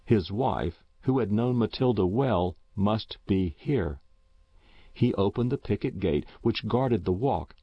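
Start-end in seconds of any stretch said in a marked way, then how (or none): none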